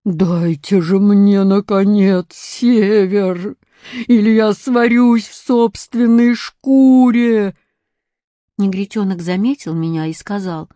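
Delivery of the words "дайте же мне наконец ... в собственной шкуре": loud, slow bear roar; big bear roaring over everyone. A narrator's reading. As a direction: bellow it like a bear cooking in its own fur. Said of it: "Белый медведь ревел так".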